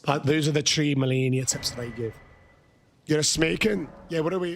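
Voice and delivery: ordinary delivery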